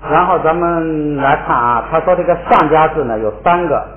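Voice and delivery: usual speaking voice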